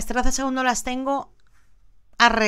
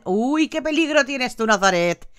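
usual speaking voice

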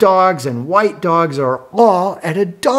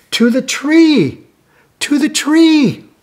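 much higher, louder cry; excited; 11 semitones higher, 4 dB louder than usual. Acted. Telling the child where the dogs go, excited. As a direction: announce it thrilled.

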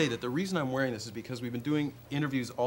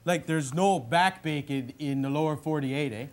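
Bob McKenzie hoser voice; 2 semitones higher than usual. Acted. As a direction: play the dopey Canadian brother hosting his bit. In character.

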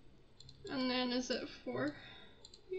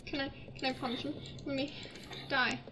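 usual speaking voice

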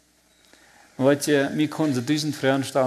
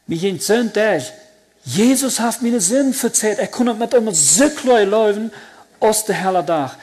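joyful outburst; 7 semitones higher, 7 dB louder than usual. Real, not acted. Overwhelming joy of forgiveness.

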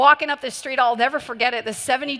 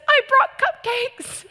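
much higher, slow, timid little voice; nervous old lady; 13 semitones higher, 4 dB louder, much slower than usual. Retold or imitated. Old lady at the brothel door, a timid high little voice. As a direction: squeak it out shyly. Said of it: "she just says".

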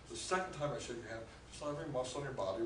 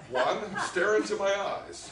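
slow loud command; 8 semitones higher, 10 dB louder, slower than usual. Shown as a demonstration. Hypnotist demo on stage.

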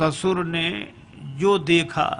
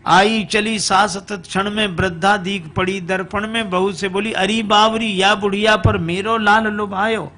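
raised, rhythmic storytelling chant; verse recitation; 4 semitones higher than usual. A narrator's reading. Mother-in-law chides the foolish bride, chanted verse. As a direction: recite the rhyme in a higher, driving voice.

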